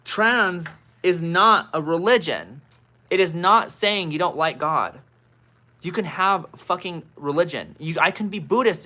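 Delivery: real heated rant, slow and loud. Real, not acted. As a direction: hammer it home, fed up. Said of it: "I'm heated".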